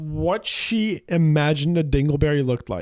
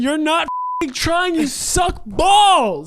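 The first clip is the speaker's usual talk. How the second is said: slow, higher, hammed-up yell; mock-angry shout; 9 semitones higher, much slower than usual. Real, not acted. Scolding partner who can't roll the R, mock angry. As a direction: fake fury, drawn out.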